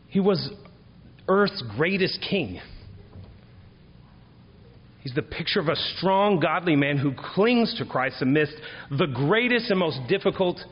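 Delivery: high, loud, ringing preacher's cadence; preaching at full force. Acted. Pastor exhorting his congregation about David, full force. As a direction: proclaim it to the back pews.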